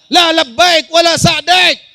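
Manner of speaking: high, shouted denunciation from the pulpit; full-force preaching. Acted. Preacher declares the pilgrimage rejected by Allah, full-force preaching. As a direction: proclaim it at the top of your voice.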